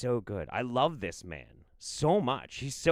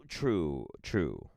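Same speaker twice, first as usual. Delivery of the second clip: dropped to a low pitch; sober agreement; 10 semitones lower than usual. Pretend. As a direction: drop down low after the squeal. Agreement dropping after high take.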